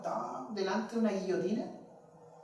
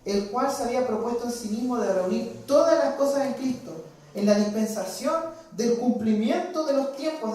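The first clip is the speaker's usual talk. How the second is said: scripture reading; 6 dB louder than usual. Acted. Preacher reading Paul's letter to the congregation, loud and solemn. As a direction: proclaim the text like a preacher.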